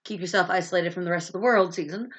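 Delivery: ordinary delivery, nothing marked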